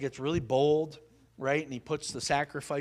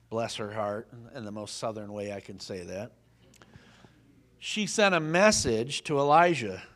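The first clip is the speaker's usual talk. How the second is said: ordinary talk, nothing marked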